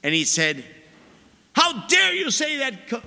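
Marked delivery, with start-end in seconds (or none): 1.5-3.0 mock furious protest, pitched up; imitating an angry parishioner; retold angry outburst. Parishioner scolding the priest after Mass, mock anger. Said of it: "he was furious at me".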